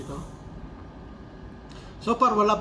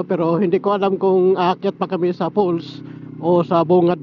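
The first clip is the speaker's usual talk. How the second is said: louder, close-miked intercom sound on the road; through helmet intercom mic; 9 dB louder than usual. Real, not acted. Vlogger testing his intercom mic on the road, louder mic sound. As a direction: talk into the helmet mic while riding. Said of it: "Titingnan ko lang kung maganda ang sounds".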